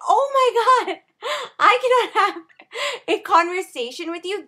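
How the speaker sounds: loud, high, fed-up cry; fed-up shout at the loud music; exasperated shout. Retold or imitated. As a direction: give up, half yelling. Exasperated at the noise.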